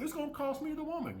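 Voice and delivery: high whiny mock voice; mock whine of a man afraid to lose her; mocking simp. Mocking a simp's worry again.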